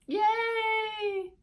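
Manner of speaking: high bright "Yay!"; excited cheer. A narrator's reading. Spiny finally catches a clam, excited cheer.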